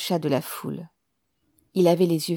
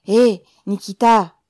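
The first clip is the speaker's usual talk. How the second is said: loud, slow call from a crowd; raised voice calling a name; 3 semitones higher, 11 dB louder than usual. A narrator's reading. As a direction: hail him loudly, stretching it. Someone calls out from the crowd.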